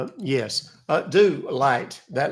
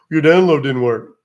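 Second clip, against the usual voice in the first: mocking scammer; 9 dB louder than usual.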